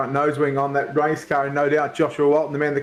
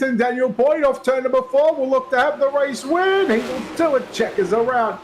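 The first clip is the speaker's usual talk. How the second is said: excited finish call; 7 semitones higher than usual. Real, not acted. Announcer calling the last lap to the checkered flag, building excitement.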